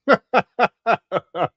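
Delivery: real laugh at a chat comment; laughing out loud. Real, not acted. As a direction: burst of high laughter. Laughs at Miss Controversy comment.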